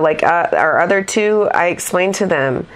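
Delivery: usual speaking voice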